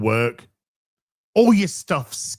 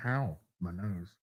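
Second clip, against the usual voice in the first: pained; 10 semitones lower, 14 dB quieter than usual. Pretend. Strained voice after mock scene.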